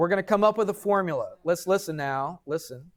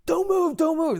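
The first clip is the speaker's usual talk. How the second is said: high, loud alarmed cry, imitated; retold frantic warning shout; panicked yell; 13 semitones higher, 4 dB louder than usual. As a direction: mimic guys freaking out below. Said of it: "They're freaking out".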